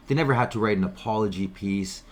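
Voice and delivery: ordinary talk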